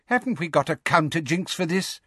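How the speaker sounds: impatient. A narrator's reading. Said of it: "Fudge asked Umbridge impatiently".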